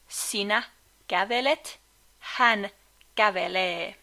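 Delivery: usual speaking voice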